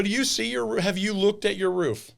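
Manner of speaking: high, loud incredulous question. Retold or imitated. Roofer confronting an angry homeowner, incredulous.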